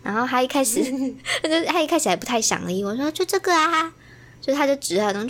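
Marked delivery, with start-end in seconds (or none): 3.1-3.9 lightly voiced child. Child answering reluctantly.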